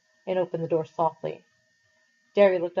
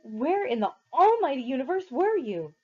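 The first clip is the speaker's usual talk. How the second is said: exasperated anger; 7 semitones higher than usual. A narrator's reading. Derry demanding where Pony was, exasperated anger. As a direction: stretch out almighty in disbelief. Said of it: "His voice was rising".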